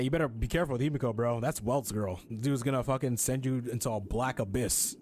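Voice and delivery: normal talking voice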